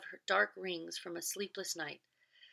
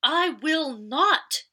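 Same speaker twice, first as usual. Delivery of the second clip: very loud, slow, higher furious shout; defiant wife screaming I will not; shouted refusal; 9 semitones higher, 14 dB louder, much slower than usual. Acted. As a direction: hurl the refusal at him. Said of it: "she shouted".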